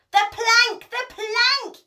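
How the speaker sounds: high, loud chant of the plank; gleeful yell. A narrator's reading. Pirates to their chief about the troll, loud high yell. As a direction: yell it out, eager for punishment. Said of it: "yelled Ben Buckle and Percy Patch".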